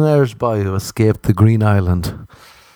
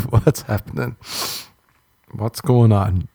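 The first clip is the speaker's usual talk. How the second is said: ordinary talk, nothing marked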